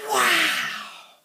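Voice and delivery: awed breathy gasp of wow; the class amazed by the toy. A narrator's reading. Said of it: "And everyone said".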